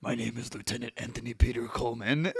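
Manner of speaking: lightly voiced gruff veteran; a hint of a hardened old soldier; grizzled war veteran. Veteran introducing himself at puppet class, lightly voiced. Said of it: "this grizzled war veteran".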